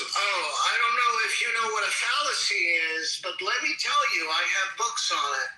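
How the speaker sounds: mocking know-it-all. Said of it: "And he goes".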